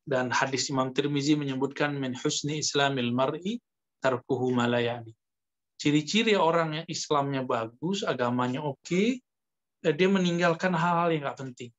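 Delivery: usual speaking voice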